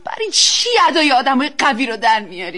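loud, anguished challenge; heated, upset accusation. Acted. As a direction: confront your husband, too loud for the house. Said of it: "آرومتر".

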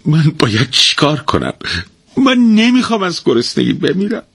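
high, pleading whine; a helpless husband pleading to his wife; naive pleading. A narrator's reading. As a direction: beg helplessly and simply. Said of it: "با تضرع و سادگی گفت".

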